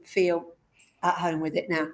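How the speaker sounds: ordinary talk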